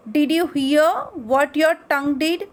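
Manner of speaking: usual speaking voice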